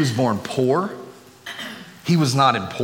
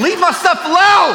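high, loud comic complaining rant; 7 dB louder than usual. Pretend. Preacher rants to church about his missing comb, high and loud. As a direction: whine about the stolen comb at full volume.

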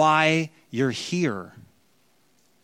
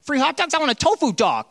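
whiny entitled visitor; 8 semitones higher than usual. Retold or imitated. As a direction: mimic a spoiled regular griping about freebies. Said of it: "coming back year after year like".

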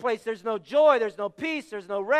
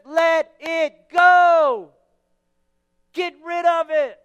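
emphatic shout; 3 semitones higher, 7 dB louder, much slower than usual. Retold or imitated. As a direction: stretch out the order and belt it. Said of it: "what Paul says".

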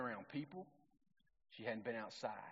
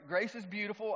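normal talking voice

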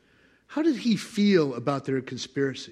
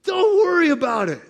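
high, loud laughing dismissal; amused God shrugging off threats; 11 semitones higher, 5 dB louder than usual. Acted. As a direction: brush them off with a laugh.

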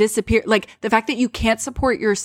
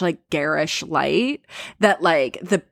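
ordinary talk

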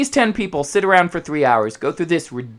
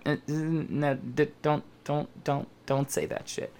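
hushed producer; 11 dB quieter than usual. Retold or imitated. Producer in their ear.

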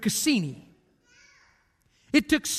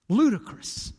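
"Ludicrous" repeated alone, in a changed tone, as a dry verdict; echoed scornful word. Shown as a demonstration. Preacher echoes James's word to the congregation, dry verdict.

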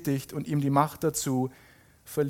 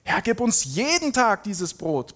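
higher, slow plea with a drawn-out stress; pleading; 8 semitones higher, slower than usual. A narrator's reading. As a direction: beg earnestly, lightly acted. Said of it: "baten sie ihn". (gespielt bittend, gedehnt)

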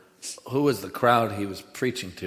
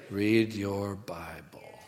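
"Read your Bible" drawn out slowly, softer; slow exhortation; 3 semitones lower, 4 dB quieter, much slower than usual. Real, not acted. Preacher urging congregation, "Read your Bible" slowly and softly. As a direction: stretch each word of "Read your Bible".

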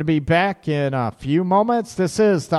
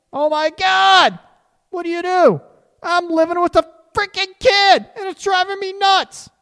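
high, loud, hammed-up exasperation; exasperated mock panic; 5 dB louder than usual. Partner fed up with living with a messy spouse, mock panicked wail. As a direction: wail it like you've hit your limit. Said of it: "all of a sudden you realize".

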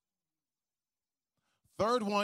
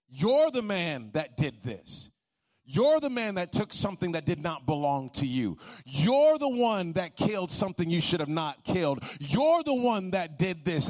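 louder, driving preacher's accusation; preaching accusation; 2 semitones higher, 6 dB louder than usual. Acted. Preacher voicing Nathan confronting David, forceful and accusing. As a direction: hammer each you're home.

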